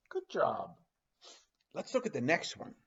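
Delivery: usual speaking voice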